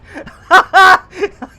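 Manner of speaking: high, loud cackle of real laughter; helpless laugh. Real, not acted. Streamer loses it at their own pun, helpless laugh. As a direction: burst out laughing.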